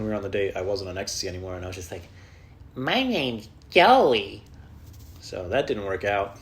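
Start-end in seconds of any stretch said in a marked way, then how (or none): 2.7-4.4 very slow, drawn out; slow awkward self-introduction; awkward slow intro. Retold or imitated. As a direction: drag it out awkwardly.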